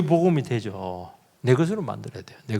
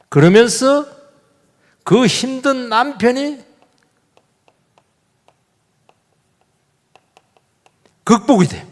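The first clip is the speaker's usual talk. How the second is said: high, slow triumphant preaching; preaching climax; 9 semitones higher, 4 dB louder, slower than usual. Acted. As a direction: lift it up like a victory. Climactic sermon point. (높고 느린 승리의 설교조)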